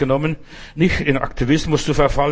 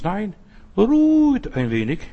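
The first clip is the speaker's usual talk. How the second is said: gentle plea; 6 semitones higher, slower than usual. Acted. Refrain after pushy mission.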